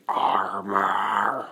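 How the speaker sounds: long drawn-out Titan word; Titan mouth voice. Acted. Izuku speaking with the Titan's mouth, drawn-out creature voice. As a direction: stretch it out through a Titan's mouth. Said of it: "or with the mouth saying".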